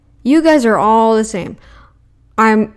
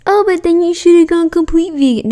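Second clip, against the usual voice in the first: higher, mocking know-it-all; mocking vegan critic; 7 semitones higher than usual. Streamer imitating a vegan critic's comeback, higher and mocking. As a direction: lightly mimic the vegan who says she should have gone further. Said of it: "I didn't feel myself".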